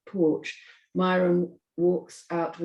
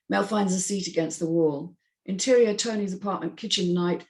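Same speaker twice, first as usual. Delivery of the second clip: normal talking voice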